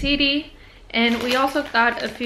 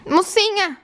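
high, loud call across the room; calling the pet; 9 semitones higher, 4 dB louder than usual. Real, not acted. Vlogger calling her pet Mocinha, loud and high. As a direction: sing out the pet's name to fetch her.

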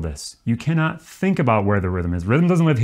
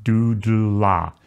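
Doodle-la drawn out slowly; practice demonstration; much slower than usual. Shown as a demonstration. Teacher showing a tongue twister to practice, slow syllables. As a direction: stretch out Doodle-la, every sound distinct. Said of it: "You gotta practice that a little bit".

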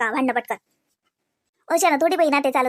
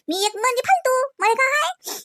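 sarcastic self-pity; 10 semitones higher than usual. Acted.

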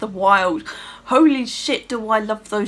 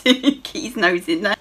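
ordinary talk, nothing marked

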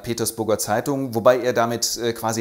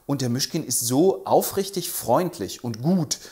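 ordinary delivery

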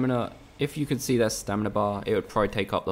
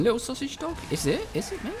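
much higher cooing voice for a little dog; pet talk. Acted. Gamer to the sausage dog, high cooing pet talk.

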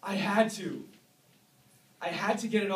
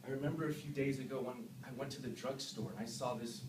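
ordinary delivery

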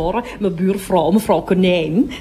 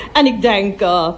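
ordinary talk

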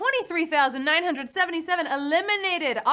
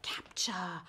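"captcha" said much softer and lower, falling away; 7 semitones lower, 13 dB quieter than usual. Shown as a demonstration. Host repeats the answer word to herself: soft "captcha". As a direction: let "captcha" fade to a soft low word. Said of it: "I like saying it like that".

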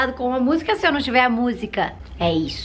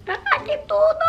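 ai que tudo bem agudo; animada. Real, not acted. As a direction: solte de empolgação. Comemora a resposta. (vibrando, com a voz bem aguda)

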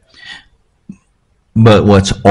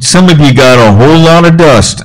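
lightly voiced old preacher, higher and teasing; Papa Don's voice; 5 semitones higher, 4 dB louder than usual. Preacher voicing his mentor's joke for laughs, light imitation. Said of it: "he talked like this".

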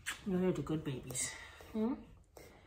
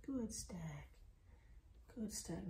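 soft whisper; 14 dB quieter, slower than usual. Real, not acted. Praising puppy softly.

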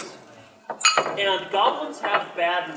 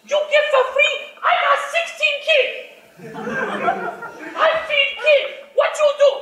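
much higher and louder scolding goblin wife; 8 dB louder, faster than usual. Acted. Goblin wife scolds husband for giving free gifts, shrill. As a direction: scold him about the sixteen kids. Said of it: "my wife comes out".

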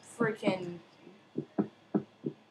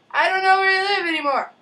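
mock wailing; 9 semitones higher, 8 dB louder, faster than usual. Wailing complaint.

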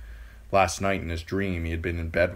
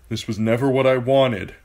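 louder, higher pained plea; anguished protest; 5 semitones higher, 7 dB louder than usual. A narrator's reading. Theon dressing the corpses, mildly anguished protest. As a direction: plead with the dead.

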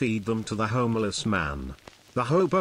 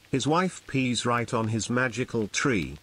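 usual speaking voice

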